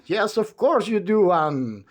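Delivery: high, babbling chatterbox voice; a high chatty voice that never stops; endless chatterer. Acted. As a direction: ramble on brightly without pausing for breath. Chattering character voice.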